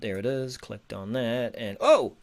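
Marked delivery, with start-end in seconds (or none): 1.8-2.1 sudden high loud oh; startled burst of surprise; surprised. Real, not acted. Page suddenly opens.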